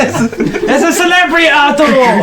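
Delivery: put-on high-pitched old gnome calling out; excited holler. Old gnome announces a visitor to his wife, excited holler. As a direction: trumpet the news to the whole house.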